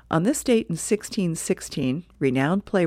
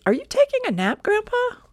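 put-on much higher small boy voice; little grandson. Nelson asking his grandpa about a nap, high small boy voice. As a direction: ask innocently like a little kid.

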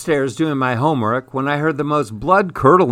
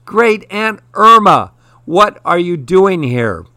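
astonished exclamation; 7 semitones higher, 5 dB louder than usual. A narrator's reading. Child unmasks great aunt in the kitchen, astonished exclamation. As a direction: exclaim it in amazement, pitch leaping up. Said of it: "I exclaimed, recognizing the voice".

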